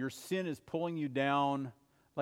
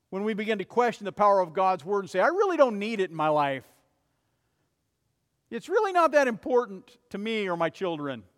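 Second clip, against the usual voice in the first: louder, fast mock voice of careless believers; dismissive quote; 3 semitones higher, 6 dB louder than usual. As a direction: scoff at God's word, pushed out loud.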